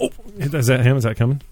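ordinary talk

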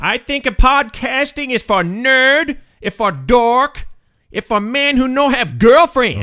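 rude foreign guest in broken English; broken-English foreign character. Acted. Comedy guest mocking the host, broken-English accent. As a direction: insult the host in a thick put-on accent.

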